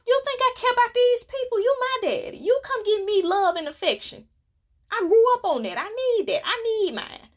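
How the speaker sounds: high, fast pouty pleading; whiny mock plea. To daddy at her job, high whiny pleading. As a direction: beg like a spoiled little girl.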